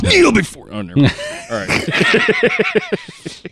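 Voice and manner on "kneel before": laughing movie quote. Retold or imitated. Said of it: "It's a movie reference".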